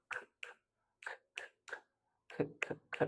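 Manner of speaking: unvoiced k breathed very softly; whispered k sound. Shown as a demonstration. Teacher whispers the k sound. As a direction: breathe each k out. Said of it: "K sounds like k".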